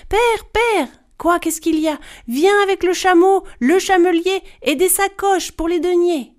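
high, loud calling out; calling from afar. Acted. Girl shouting to her father from far away. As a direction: call across the distance to father.

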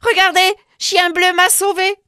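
put-on girl presenting her dog to her parents; excited girl. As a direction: show off her rescuer. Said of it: "cria Charlotte".

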